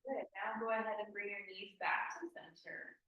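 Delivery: normal talking voice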